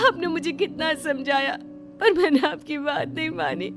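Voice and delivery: sobbing in regret between phrases; tearful, remorseful lament; crying. Acted. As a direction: speak through tears and gasps. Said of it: "रोने लगती है".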